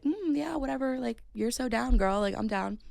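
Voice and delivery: high, airy mock nonchalance; playing innocent. Storyteller imitating the man playing innocent, mock casual. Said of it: "innocent and be like".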